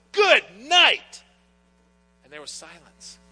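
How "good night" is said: put-on a sudden high, loud shout over the bickering kids. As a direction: bark it out to silence the back seat, much higher and louder. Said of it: "I say in a loud voice".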